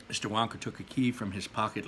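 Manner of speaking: plain reading voice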